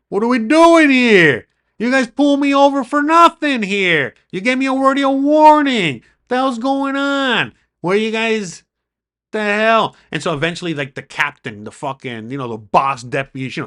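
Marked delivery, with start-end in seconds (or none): 0.1-1.5 high, loud and slow, demanding; retold indignant yell. Driver yelling at deputies during the stop, indignant. 1.8-9.9 high, loud outraged protest, slowed; retold indignant yell. Driver yelling at deputies who pulled him over, loud and outraged.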